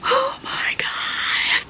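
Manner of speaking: high, slow, breathy gasp; gasping whisper. Real, not acted. Stunned reader at the twist, gasping whisper. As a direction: let it slip out in shock. Shock at a reveal.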